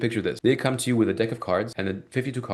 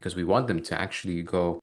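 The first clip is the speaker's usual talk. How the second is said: normal talking voice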